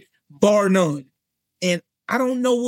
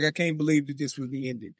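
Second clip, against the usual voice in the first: softer, faster, lower stunned mutter; muttered disbelief; 7 semitones lower, 8 dB quieter, faster than usual. Retold or imitated. Fan at the ending, in a low, soft, fast mutter. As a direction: let it fall out of you, low and quick. Retold disbelief at show end.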